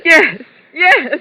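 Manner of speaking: tearful shaky yes; frightened. Acted. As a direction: near tears, clinging to him. Frightened woman after attack.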